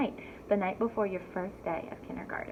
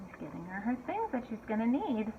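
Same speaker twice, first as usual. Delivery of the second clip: ordinary delivery, nothing marked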